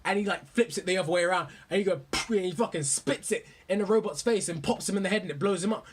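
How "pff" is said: sharp puff through the lips; imitated spit. Reviewer showing the robot spitting a bullet, imitated puff.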